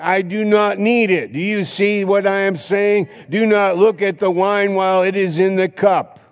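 high, loud preaching peak; fervent preaching. Real, not acted. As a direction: drive it home with fire. Preacher rising to full force.

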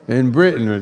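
ordinary delivery

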